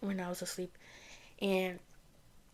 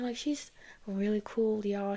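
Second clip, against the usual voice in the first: usual speaking voice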